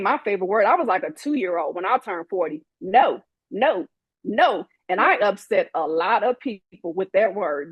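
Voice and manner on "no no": ordinary delivery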